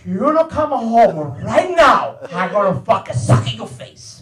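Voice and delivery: imitating a furious Italian dad, drawing it out; mock mad father. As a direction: shout the name, then slow threat. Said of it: "So my father gets mad".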